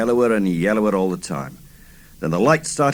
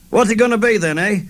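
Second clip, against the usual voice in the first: high loud challenge; calling out; 11 semitones higher, 4 dB louder than usual. A narrator's reading. Droog calling out to the gang, loud and high. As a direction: toss the question out loud and high. Narrator voicing droog's catchphrase.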